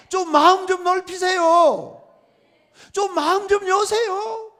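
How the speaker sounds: high, loud, drawn-out plea; preacher's full-voiced appeal; shouted exhortation. Real, not acted. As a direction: cry out to the congregation, stretching the words. Preacher pleading with congregation.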